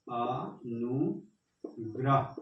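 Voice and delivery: अनुग्रह stretched out very slowly; slow dictation. Shown as a demonstration. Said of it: "फिर हम लोग लिखेंगे अनुग्रह". (धीरे-धीरे लिखवाना)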